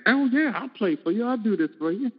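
chirpy offer from an eager sideman; eager musician voice. Retold or imitated. Musicians offering to play for her.

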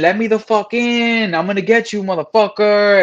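put-on shouting through the door like a crazed kid; retold screaming. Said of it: "screaming and yelling".

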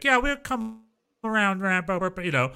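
a small-town Texas voice, played for laughs; Texan yokel accent. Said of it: "with their accents".

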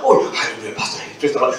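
imitated low-pitched, subdued hallelujah; changed voice. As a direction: drop the voice on recognizing the pastor. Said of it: "Suaranya berubah".

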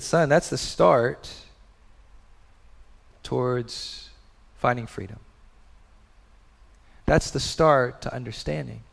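gentle fatherly. Retold or imitated. Father gently counseling son.

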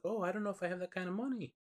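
high, quick, fake-sweet girl voice; high fake-innocent squeak; mock innocent girl. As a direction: play coy and helpless. [name] feigning innocence to Mary.

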